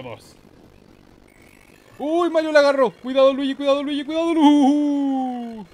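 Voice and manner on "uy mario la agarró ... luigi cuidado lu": high, loud urgent cries; excited warning. Real, not acted. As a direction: shout a warning at the screen. Warns Luigi during the race.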